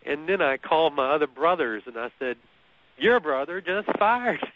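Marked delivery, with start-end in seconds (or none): 3.0-4.5 high, slow mock announcement. Retold calls to family, comic build.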